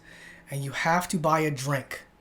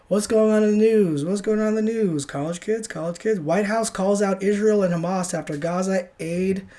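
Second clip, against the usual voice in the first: raised, projected headline voice; punchy, repetitive newsreader hype; news segment intro; 4 semitones higher, 5 dB louder than usual. Acted. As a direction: announce the news segment high and loud. Launches a mock news segment.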